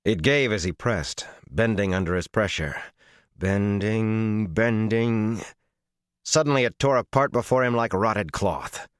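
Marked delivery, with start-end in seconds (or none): none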